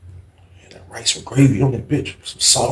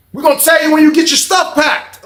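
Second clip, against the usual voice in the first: high loud guard voice; 5 dB louder than usual. Retold or imitated. Guard replying.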